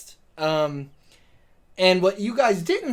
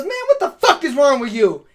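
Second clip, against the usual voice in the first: loud, higher, laughing outrage; mock outrage; 6 semitones higher, 5 dB louder than usual. Host acting the woman telling off the driver, mock outrage. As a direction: act indignant through a laugh. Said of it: "And she goes".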